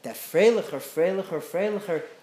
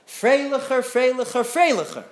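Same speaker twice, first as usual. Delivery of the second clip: put-on boy's rhythmic chant, raised and higher; mock yell; 6 semitones higher, 4 dB louder than usual. Boy yelling to the organ grinder to keep the beat, chanting. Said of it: "yell for him the whole time".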